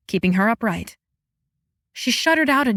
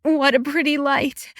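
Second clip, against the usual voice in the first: tremulous; 5 semitones higher than usual. A narrator's reading. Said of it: "a tremulous".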